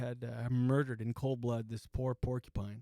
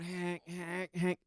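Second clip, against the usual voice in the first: imitated animal honk; 3 semitones higher, much slower than usual. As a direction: mimic a wounded animal's repeated honk. Imitates dying porcupine's noise.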